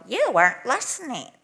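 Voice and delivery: scolding teacher. Retold or imitated. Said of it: "you don't go".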